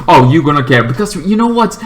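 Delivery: usual speaking voice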